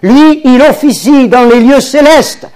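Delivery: high, loud fervent preaching; preaching at full force. Acted. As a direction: lift the congregation, build to Alléluia.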